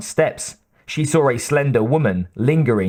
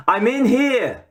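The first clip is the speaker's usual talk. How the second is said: put-on much higher girl calling out, Boston accent; young Boston accent calling; 11 semitones higher than usual. A narrator's reading. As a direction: call from another room, Boston accent. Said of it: "the voice called from the office".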